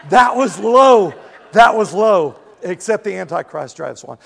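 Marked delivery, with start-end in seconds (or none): 0.1-2.4 loud, slow, laughing self-reproach; laughing at himself. Real, not acted. Pastor laughing at his own Prius jab, loud and amused. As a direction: chuckle through the words, caught out by your own joke.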